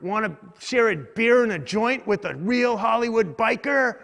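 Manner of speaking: imitating a biker hailing someone; biker's come-on. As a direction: call out an invitation like a rowdy street biker. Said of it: "and he's like".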